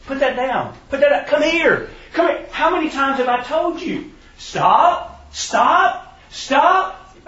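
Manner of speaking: high, loud scolding building to stop; parent yelling. Retold or imitated. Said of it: "yelling at their kid all through Walmart".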